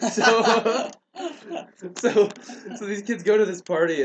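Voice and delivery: high, laughing speech; cracking up while restarting the story. Real, not acted. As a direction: try to carry on through real laughter. Cracks up after absurd quote.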